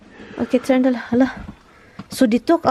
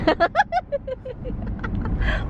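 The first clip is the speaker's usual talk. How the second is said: soft high giggle; 12 semitones higher, 6 dB quieter than usual. Real, not acted. Laughing softly while tickled.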